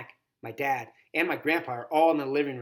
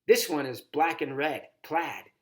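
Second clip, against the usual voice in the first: ordinary delivery, nothing marked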